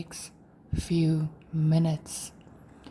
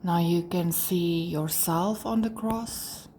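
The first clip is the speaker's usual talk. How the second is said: guided meditation. Acted. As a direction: lead them gently through breathing and release, pausing between phrases.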